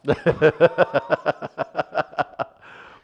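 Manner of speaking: loud, high burst of laughter; long hearty laugh. Real, not acted. Host laughing hard at the impression. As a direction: let it roll out.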